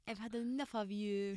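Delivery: British accent on request. Shown as a demonstration. Asked by host to do British, fed-up British accent. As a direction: say it as a fed-up Brit breaking up. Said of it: "Can you do British or can you do French?".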